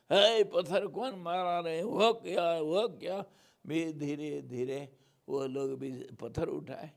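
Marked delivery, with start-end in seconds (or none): 0.1-3.2 loud, higher cry of alarm; imitating a startled, challenging yell; acted alarmed shout. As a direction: call out loudly over a crowd. Acts out crowd shout at riot.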